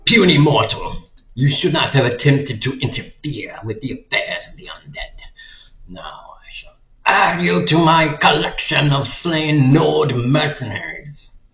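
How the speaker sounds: booming undead villain voice; loud menacing lich taunt; dragon voice. Acted. Reading game lich dialogue aloud.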